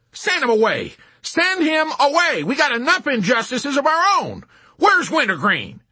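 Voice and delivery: high, loud frantic shouting; frantic orders. A narrator's reading. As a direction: dismiss him in a panic. Hysterical general giving orders.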